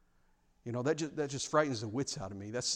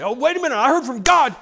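mock huffy protest, much higher and louder; huffy indignant outburst; 11 semitones higher, 12 dB louder than usual. Imitating a huffy prophet rebuffed by a listener, loud mock indignation. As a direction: play the offended prophet, indignant. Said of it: "You don't get huffy".

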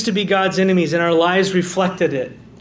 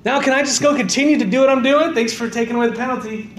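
flippant ungrateful sinner; 7 semitones higher than usual. Retold or imitated. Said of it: "And you go".

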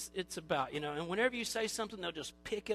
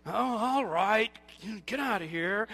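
mock grudging pardon; 6 dB louder than usual. Elder excusing a young person, loud mock-grudging. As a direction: let them off with a playful grumble.

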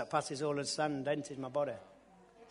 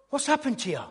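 higher, louder mock wife; amazed wife; 10 semitones higher, 6 dB louder than usual. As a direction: play the astonished wife. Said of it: "she went".

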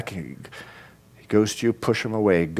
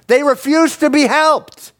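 very high, strained cry; impassioned exclamation; 5 dB louder than usual. Real, not acted. Preacher on Israel refusing God's help, crying out. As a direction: exclaim it at the top of your range.

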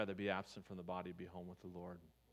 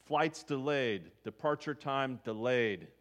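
slow, loud airport board reading; drawn-out announcement; 7 dB louder, slower than usual. Retold or imitated. Traveler reading the delay board, slow loud groan. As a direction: drag it out like bad news. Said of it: "It's like".

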